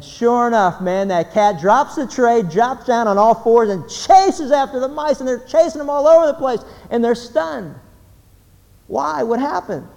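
building excitement. Acted. Cat chaos climax.

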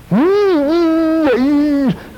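high nasal snort through flared nostrils; angry snorting hum. Retold or imitated. The pastor voices Nebuchadnezzar's nostrils flaring, snorting high. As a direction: snort like a ticked-off boss. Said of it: "It's like".

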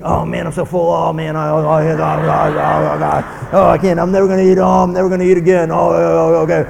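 hammed-up groan of the overfed; grumbling. Overfull eater after the meal, groaning and grumbling. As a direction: build from a moan to loud groaning protest. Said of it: "We grumble about everything".

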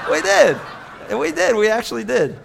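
laughing. Real, not acted. Laughing about giving parrot away.

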